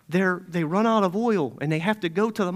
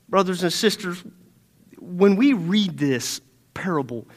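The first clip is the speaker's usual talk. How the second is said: ordinary talk, nothing marked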